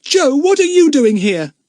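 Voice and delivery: put-on high, loud boy's question; astonished cry. A narrator's reading. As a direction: call out in startled surprise. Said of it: "cried Jack".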